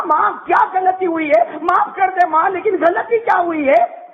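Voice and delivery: high pleading shout to his mother; anguished plea. Retold or imitated. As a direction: cry out begging to know his fault. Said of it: "اور کہا".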